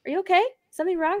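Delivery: imitating mom's high-pitched concern; high, anxious-sounding checking in; worried mom. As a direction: voice a mother fretting over her kid. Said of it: "She always thinks, like".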